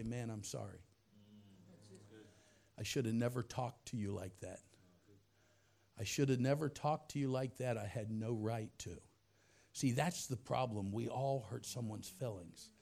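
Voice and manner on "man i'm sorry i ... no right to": much softer and lower, sincere; sincere apology. Shown as a demonstration. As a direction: apologise gently and humbly. Model apology.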